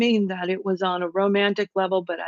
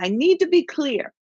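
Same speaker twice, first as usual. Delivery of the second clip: much higher voice, mimicking a friend; imitating Gemini friend; 8 semitones higher than usual. Reader shows how her Gemini friend would say it, higher mimicry. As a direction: lift the pitch sharply to mimic her. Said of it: "she would say something like that".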